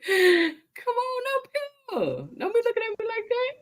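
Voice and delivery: high sing-song pet voice; high baby talk. Acted. Coaxing a pet on camera.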